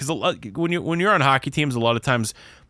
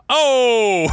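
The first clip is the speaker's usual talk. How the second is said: crowd yell; 12 semitones higher, 6 dB louder than usual. Retold or imitated. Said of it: "I just heard in the crowd".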